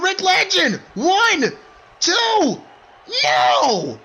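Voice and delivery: high, loud, breathless yelling; frantic shouting. Acted. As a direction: scream every near fall. Frantic back and forth pinfall counts.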